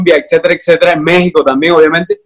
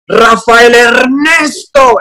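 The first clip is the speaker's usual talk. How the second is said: slow scolding mother's call, pitched higher; mother calling sternly; 7 semitones higher, much slower than usual. Retold or imitated. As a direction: call the full name like a mom about to scold. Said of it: "cuando mi mamá me decía". (madre regañando, llamando despacio y más agudo)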